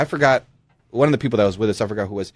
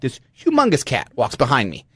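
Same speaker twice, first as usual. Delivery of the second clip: ordinary talk, nothing marked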